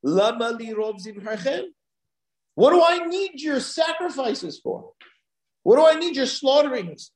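high-pitched harsh rebuke; God, harsh. Acted. God rejecting the people's sacrifices, harshly questioning. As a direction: demand it harshly, pitched well up. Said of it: "very harshly".

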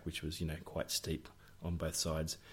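ordinary talk, nothing marked

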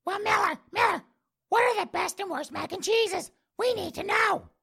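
high-pitched mock falsetto of a pestering listener; mock fan voice. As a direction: whine it like an eager fan in falsetto. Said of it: "People are out there going".

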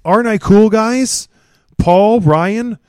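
hammed-up try-hard seeking praise; mock show-off. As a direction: fish for approval from the guys. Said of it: "how cool J R is".

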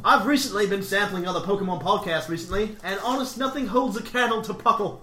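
wobbly put-on Australian, half British; Australian accent slipping into British. Host reading Australian listener's mail, accent drifting British. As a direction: attempt Aussie, drift into mock British. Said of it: "I have definitely gone British".

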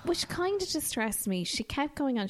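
ordinary talk

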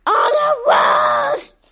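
building to a high, loud, drawn-out shout; monster voice. A narrator's reading. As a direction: scold like a monster, climbing to a screech. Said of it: "but the monster said".

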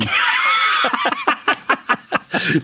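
squealing burst of laughter; high shrieking laugh. Real, not acted. Laughing at own joke.

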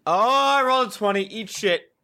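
loud, high triumphant crowing; gloating cheer. Real, not acted. Player gloats over a top dice roll, loud gloating cheer.

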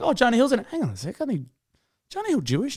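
high, soft mock realisation. Co-host acting out the viewer's dawning thought, high soft voice. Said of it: "then he's gone like".

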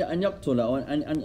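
ordinary talk

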